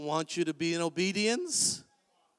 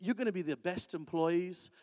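ordinary delivery

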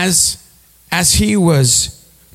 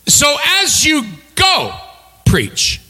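"preach" as the payoff word, set apart by a pause; stress on preach; 12 semitones higher, slower than usual. Shown as a demonstration. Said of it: "the emphasis is on the preach".

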